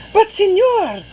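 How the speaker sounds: put-on high, loud little girl's voice; Anna pipes up eagerly. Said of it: "Anna says".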